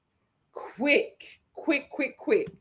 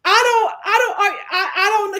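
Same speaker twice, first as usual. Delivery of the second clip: laughing; 5 semitones higher, 4 dB louder, faster than usual. Real, not acted. Laughing, flustered.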